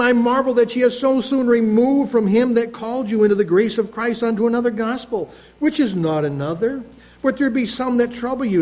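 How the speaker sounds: scripture reading. Acted. Preacher reading Galatians aloud to his congregation, proclaiming. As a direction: proclaim the verse like a preacher at the pulpit.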